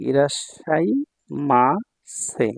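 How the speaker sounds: ইরাশাইমাসে drawn out very slowly, part by part; very slow teaching model of ইরাশাইমাসে, closing on "সে"; final "সে" sound. Shown as a demonstration. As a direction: break ইরাশাইমাসে into slow pieces, ending on a clear "সে". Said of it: "এখানে সে হবে".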